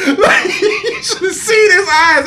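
high, loud laughing through the words; can barely get it out for laughing; laughing hard while recalling the friend's stunned face. Real, not acted. Laughing retelling friend's reaction.

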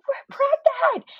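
high, slow, ecstatic shout; wife screaming good news to husband on the phone, very high and slow. Retold or imitated. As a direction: stretch it out in a thrilled scream. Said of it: "the screaming voice".

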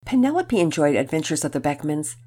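ordinary delivery, nothing marked

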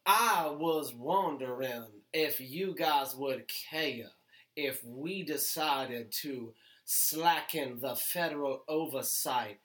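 put-on low, slow, soft Southern gentleman accent; genteel Southern drawl. Sessions asking to cut oversight, low soft Southern drawl. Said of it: "talks like a genteel Southern slave owner".

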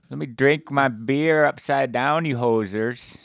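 high, loud hammed-up Canadian hoser, eh; loud, high Canadian hoser clowning; mock hoser accent. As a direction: play a goofy Canadian hoser showing off upside down.

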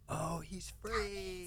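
soft, drawn-out, high mock wonder; dawning realization. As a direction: let the realization stretch out, hushed and high.

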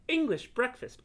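astonished echo. Acted. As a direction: echo the name in amazement. Cat repeats name in surprise.

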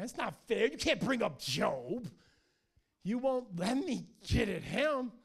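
hammed-up tantrum, loud and stretched; loud whining. Satan objecting to God bringing up Job, whining loudly. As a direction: protest like a child denied a turn. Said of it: "he whines to God".

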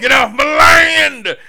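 loud, slow, high cranky yell; yelling hobo. Retold or imitated. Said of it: "weird hobos".

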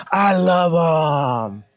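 slow, drawn-out tender close. Acted. God telling the church he loves them, slow and tender. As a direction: let it melt, very slowly. God's words slowed, drawn out.

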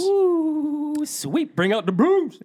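high, loud playful whoop; excited hooting. Pretend. Co-host reacting to a sweep prediction, excited hooting. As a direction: crow it high and mock-impressed.

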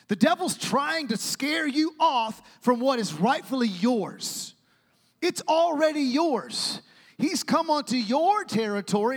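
high, loud, drawn-out sermon delivery; full-force preaching. Real, not acted. Preacher warns the devil wants what's yours, full-force preaching. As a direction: drive each phrase home from the pulpit.

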